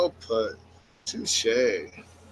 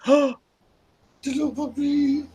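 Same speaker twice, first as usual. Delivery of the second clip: high, very slow pitying coo; mock sad coo; 9 semitones higher, 3 dB quieter, much slower than usual. Mourning a puppy killed in the game, a slow high coo.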